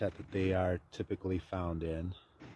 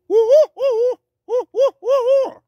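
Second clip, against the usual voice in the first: high, loud, short choppy hoots; choppy male owl hoot; 16 dB louder than usual. Shown as a demonstration. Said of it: "shorter and choppier".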